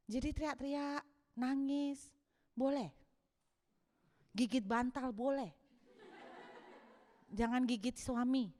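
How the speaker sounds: usual speaking voice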